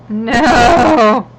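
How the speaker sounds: shocked. Real, not acted. Shocked at stolen spot.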